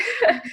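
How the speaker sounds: a laugh that breaks into crying; tearful laugh. Real, not acted. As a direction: laugh through welling tears. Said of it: "I'm gonna get a bit teary-eyed".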